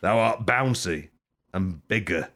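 normal talking voice

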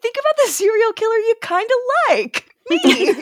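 high, quick teasing self-pitch; playful Kai. Pretend. Friend jokes as Kai pitching himself, high and quick. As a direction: sell yourself like a cheeky killer.

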